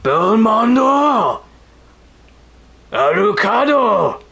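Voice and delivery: hammed-up battle cry, high and drawn out; Soma. Streamer quoting Soma before the Julius mode fight, hammed-up shout. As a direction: stretch each name into a loud, soaring mock shout. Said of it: "he says".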